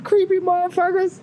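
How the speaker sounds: high-pitched mock outrage; creeped-out disbelief. Reacting to being secretly photographed.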